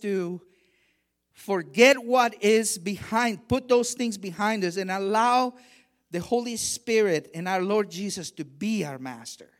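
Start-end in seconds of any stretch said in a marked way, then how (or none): none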